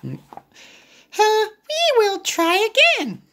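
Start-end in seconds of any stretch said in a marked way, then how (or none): 1.2-3.2 high little pig voice. Acted. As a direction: pitch way up, cheery little pig. Piggy's line.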